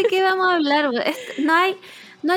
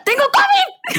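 high shrill exclamation; slower than usual. Retold or imitated. Friend's dramatic reply quoted.

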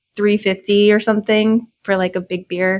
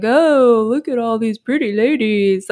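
put-on drunk guy voice; 4 dB louder than usual. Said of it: "like, super drunk and stuff".

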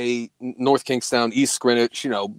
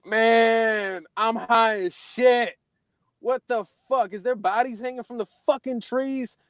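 disbelieving; 10 semitones higher than usual. Retold or imitated. Retold disbelief at hanging shadows.

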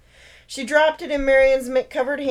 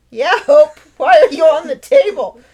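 teasing; 5 semitones higher, 11 dB louder than usual. Acted. Brother teasing his sister.

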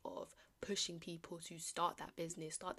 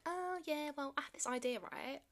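mocking dawdler; 9 semitones higher than usual. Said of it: "sitting there going".

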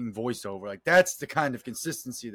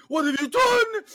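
imitating a shocked officer, pitched way up and louder; high squeaky quote; 7 dB louder, slower than usual. Friend retelling a movie scene, squeaky mock shock. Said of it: "this guy's like".

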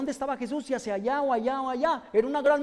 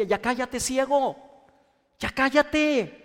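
rising from mild to loud, higher hush; crowd hushing him; 4 semitones higher, 4 dB louder, slower than usual. Retold or imitated. The crowd silencing Bartimeo, rising annoyed shout. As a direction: tell the blind man to shut up. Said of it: "toda la gente le decía".

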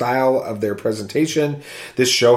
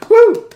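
high, loud "woo!" cheer; excited whoop; 10 dB louder than usual. Real, not acted. Host cheering for a listener's review, excited whoop. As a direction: whoop it out in celebration.

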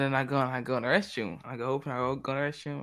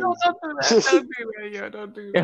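higher, louder, protesting through laughter; laughing protest; 8 semitones higher, 8 dB louder than usual. Real, not acted. Teased friend objects to peer pressure jab, laughing protest. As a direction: laugh while telling them to stop.

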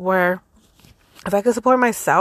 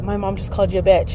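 ordinary talk, nothing marked

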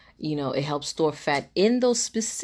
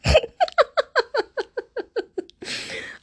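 high cackling laugh; real high-pitched laugh; loud high laugh; 4 dB louder than usual. Real, not acted. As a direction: burst out laughing at herself. Laughing at own skit.

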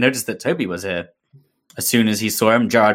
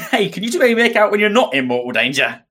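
put-on a touch of a Cockney accent; lightly Cockney Matt; 10 semitones higher than usual. Rereading Matt's line, still a bit Cockney. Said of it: "why are you going Cockney?".

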